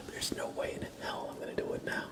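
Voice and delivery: ordinary delivery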